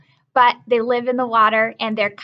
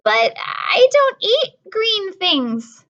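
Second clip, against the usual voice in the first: put-on higher, slow, whining little girl; whiny protest; 9 semitones higher, 4 dB louder, much slower than usual. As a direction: whine like a fussy little girl. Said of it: "Lola said".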